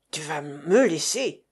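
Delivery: low growling threat; low Russian growl. Acted. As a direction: growl it at him. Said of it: "gronda le Russe".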